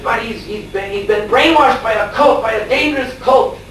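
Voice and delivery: high, rising indignant pleading; imitated agitated protest. Father protesting to the police, imitated high agitation. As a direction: stammer into a high, mock-frantic protest.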